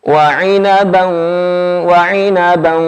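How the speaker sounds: slow, chanted Quranic recitation. Shown as a demonstration. Teacher models the verse for students, slow chant. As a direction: recite it slowly, each letter placed.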